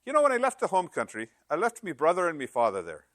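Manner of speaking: high, lilting Irish brogue; joke-telling Irishman with a high brogue. Acted. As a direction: tell it in a put-on Irish brogue. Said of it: "I gotta say this in a brogue".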